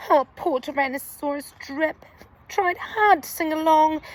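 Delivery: ordinary delivery, nothing marked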